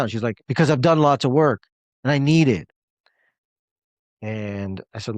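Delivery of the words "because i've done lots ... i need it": louder, higher insisting, imitating her; insistent defensive. Coach imitating a client insisting she needs help, louder and higher. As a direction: mimic her stubborn insistence. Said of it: "she's defensive".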